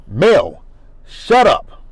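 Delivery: loud fed-up outburst; exasperated shout. Real, not acted. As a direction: cut her off loudly in disbelief. Host snaps at castmate.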